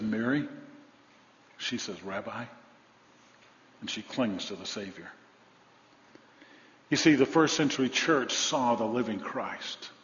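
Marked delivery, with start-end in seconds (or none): 0.0-5.1 soft, low tender voicing; gently, as in the garden; Mary meeting the risen Jesus, softly; tender hush. Real, not acted.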